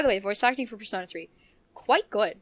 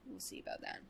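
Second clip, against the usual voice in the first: teasing whisper; 20 dB quieter, faster than usual. Real, not acted. Teasing about playing Persona five.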